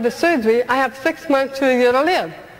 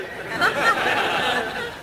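high airy laugh; 12 semitones higher, 5 dB quieter than usual. Real, not acted. After her road trip joke, real laugh. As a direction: laugh at her own punchline.

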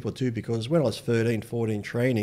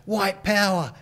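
high, drawn-out mock heckle; imitating a shout from down the street; yelled out; 9 semitones higher, 4 dB louder, slower than usual. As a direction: yell it out like a passer-by calling after someone. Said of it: "they're yelling out".

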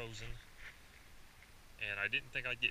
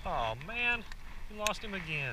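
high, loud and breathless; shouting with thrill, much higher; excited; 10 semitones higher, 7 dB louder than usual. Real, not acted. Chasing a running fish.

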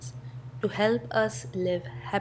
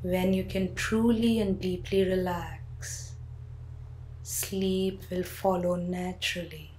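guided sleep meditation. Acted. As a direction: talk the listener gently down into sleep.

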